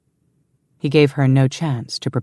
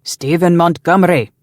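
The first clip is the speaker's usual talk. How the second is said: raised, ringing announcement of a name; loud declaration; 4 semitones higher, 7 dB louder than usual. A narrator's reading. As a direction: call it up to the wall, loud and higher. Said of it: "he declared loudly".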